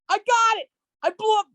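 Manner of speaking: high, loud excited re-enactment; hyped-up mock announcement; excited boast. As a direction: yell it out in overeager triumph. Acting out his own excited self.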